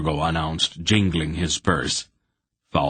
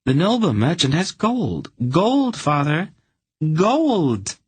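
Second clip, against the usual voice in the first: much higher greedy daughter's voice, building; a daughter begging, pitched up and louder; Anhelde pleading; 11 semitones higher, 3 dB louder than usual. A narrator's reading. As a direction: drag out gold, wheedling her father. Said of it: "Anhelde protested".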